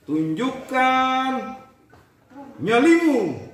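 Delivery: very slow, beat by beat; drawn out to the beat; slow rhythmic call. Shown as a demonstration. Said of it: "Begini intonasinya atau metronomnya". (tunjukkan nyalimu sangat lambat, ikuti ketukan)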